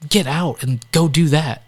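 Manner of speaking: urgent push. Retold or imitated. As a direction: push him out the door, slow. Counselor urging him to leave.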